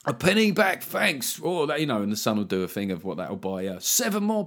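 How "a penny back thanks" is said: higher, chirpy mock thanks; lightly voiced cheery punter. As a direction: play a grateful tabloid reader. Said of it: "right-wing press".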